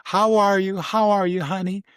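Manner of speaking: high, excited motherly voice on the radio; a doting mom gushing over a crackly line; imitating his mom. Mom hears son on ham radio, gushes high and excited. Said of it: "and she go".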